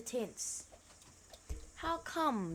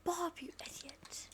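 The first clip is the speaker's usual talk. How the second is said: whisper; 2 semitones lower, 7 dB quieter than usual. Real, not acted. Unvoiced whisper lines.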